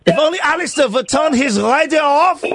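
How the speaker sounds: put-on high, pleading Swiss accent; mock-wistful comic Swiss accent. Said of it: "It's a Swiss accent".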